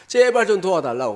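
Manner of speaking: a woman crying out for help, loud; desperate plea. Acted. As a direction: beg him, voice rising. Woman begging Jesus, acted.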